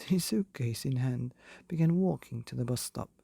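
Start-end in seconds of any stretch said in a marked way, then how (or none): none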